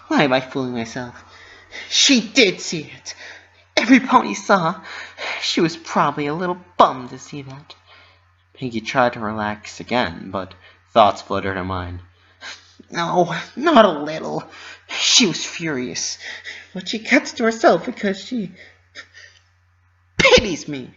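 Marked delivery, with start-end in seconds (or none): 0.1-7.7 high, fast self-doubting voice; doubting Pinkie. Acted. Pinky admitting Minty saw it, high worried voice. 12.9-20.9 high voice sinking into dread; dread Pinkie. Acted. Pinky imagining Minty furious, high anxious voice.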